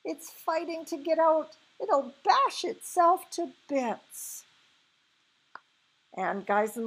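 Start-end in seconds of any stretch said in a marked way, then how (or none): 0.0-4.4 very high, urgent outcry; crying out. A narrator's reading. Sophie watching it thrash, crying out high. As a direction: cry it out in alarm, pitch way up. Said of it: "Sophie cried".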